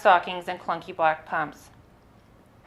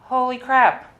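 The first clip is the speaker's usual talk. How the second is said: shocked exclamation; 6 semitones higher, 5 dB louder, slower than usual. Real, not acted. Shock at brothel stop.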